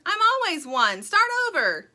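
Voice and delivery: loud, high indignant demand; yelling. A narrator's reading. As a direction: shout down the other counter. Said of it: "yelled Sugar".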